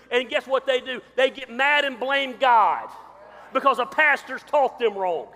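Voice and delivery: loud, high preacher's cadence, hammering each line; full-force preaching. Acted. Pastor drives the point home to his congregation, loud fiery preaching. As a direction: preach it hard to the whole church.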